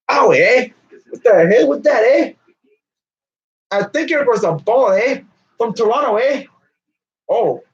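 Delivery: loud, high mock Canadian accent full of eh; hammed-up Canadian accent, shouting at the ball; bad Canadian accent. Streamer fakes a Canadian accent for a fan hit by a ball. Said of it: "I can't do Canadian".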